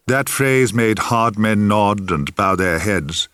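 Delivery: ordinary delivery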